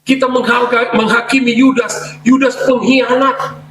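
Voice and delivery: high, pressing preacher voice; rising sermon challenge; fervent preaching. Real, not acted. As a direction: drive the rebuke home to the congregation.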